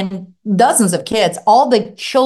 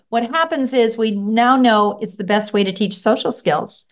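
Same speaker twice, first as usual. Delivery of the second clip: ordinary talk, nothing marked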